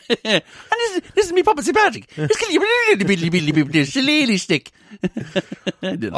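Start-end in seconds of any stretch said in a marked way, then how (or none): 0.1-4.7 puppet Saint Patrick. Pretend.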